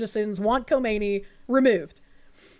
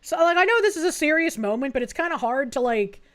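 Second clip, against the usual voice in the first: ordinary talk, nothing marked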